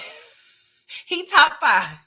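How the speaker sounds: slow words through laughter; laughing. Real, not acted. Host laughing while ranking annoying cops, drawn-out laugh. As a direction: laugh as you drag it out.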